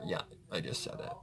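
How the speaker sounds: quick sheepish whisper; whispered aside. Real, not acted. Admitting to viewers she swore, in a fast sheepish whisper. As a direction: own the swear under your breath.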